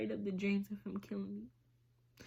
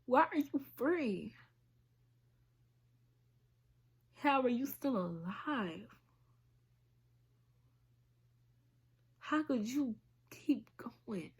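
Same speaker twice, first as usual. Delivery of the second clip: real anger. Real, not acted. Survivor confronting her abuser through the camera, angry. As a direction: confront him, demanding answers. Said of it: "I am angry".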